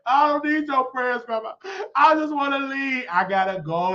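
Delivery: high-pitched mock tearful protest; crying like baby. Recruit refusing grandma's prayers, mock baby crying. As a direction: whine and plead like a crying baby. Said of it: "I'm crying like a baby".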